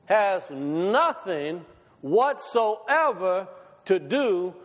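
drawn-out, weighty pulpit declaration; slow preaching emphasis. Acted. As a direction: preach it slowly, every word landing. Preaching the key point slowly.

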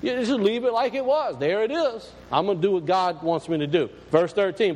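usual speaking voice

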